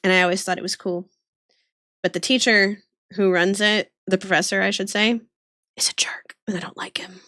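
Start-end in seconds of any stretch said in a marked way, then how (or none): 5.8-7.2 unvoiced whisper, a secret aside. Real, not acted. Whispering to her call friends that he's a jerk. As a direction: whisper it so he can't hear. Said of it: "whispered confession about professor".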